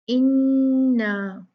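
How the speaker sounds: इन्ना with a long nasal ghunna on the n; ghunna on inna. Shown as a demonstration. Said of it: "इसको हमने कैसे पढ़ना है? गुन्ना के साथ". (इन्ना में नून पर गुन्ना)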